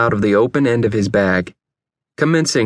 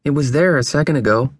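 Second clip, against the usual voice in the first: ordinary delivery, nothing marked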